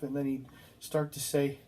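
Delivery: ordinary delivery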